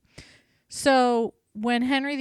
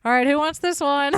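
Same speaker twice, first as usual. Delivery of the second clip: louder auctioneer call to the crowd; 4 semitones higher, 6 dB louder than usual. Acted. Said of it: "He goes".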